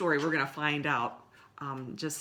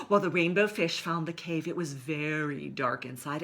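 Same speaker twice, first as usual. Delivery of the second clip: plain reading voice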